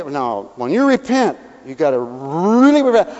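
normal talking voice

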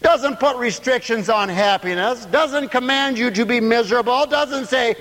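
high, drawn-out preacher's cadence; full-voiced preaching. Real, not acted. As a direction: preach it out, stretching the phrases high. Preaches at raised pitch.

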